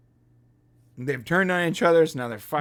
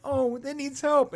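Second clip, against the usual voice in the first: high, slow mock sympathy; imitated concerned character; 9 semitones higher than usual. Retelling Uhura's character seeing the hurt one, mock concern. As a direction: raise the voice and stretch out the pity. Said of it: "She's like".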